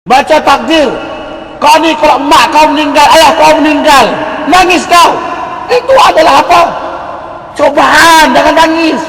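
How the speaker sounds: loud, high preacher's cry; preaching at full force. Acted. Preacher rousing the congregation with a loud high cry. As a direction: lift the voice and press the point.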